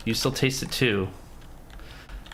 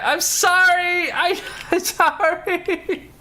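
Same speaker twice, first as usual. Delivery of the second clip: very high, slow, laughing squeak of sorry; squeaky laughing sorry; giggly apology; much slower than usual. Real, not acted. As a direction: apologize while cracking up. Laughing apology after outburst.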